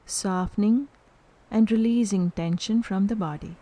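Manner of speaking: calm guided meditation with long pauses; unhurried, evenly spoken meditation instructions. Real, not acted. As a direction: lead them into stillness, leaving silence between phrases. Leads a breath meditation.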